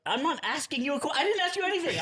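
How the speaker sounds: high, offended protest; indignant tone. Acted. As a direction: act wounded and defensive. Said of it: "I'm just giving you an indignant tone".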